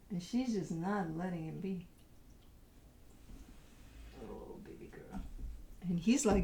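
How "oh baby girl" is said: whisper. Real, not acted. Owner cooing at her dog.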